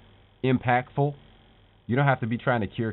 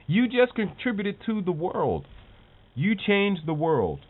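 higher and slow, building awe; rising excitement; 7 semitones higher, slower than usual. Real, not acted. Vlogger hyping his viewers, slow and high-pitched. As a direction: land the big payoff slowly and high.

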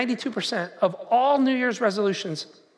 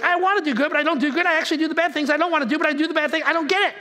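high, rattled-off complaint; acted exasperated confusion; 5 semitones higher, faster than usual. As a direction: run on in mock bafflement, pitched up. Preacher acts out Paul's confusion.

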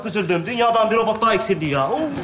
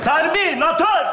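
dramatic slow call; 6 semitones higher, much slower than usual. Acted. Calling out dramatically.

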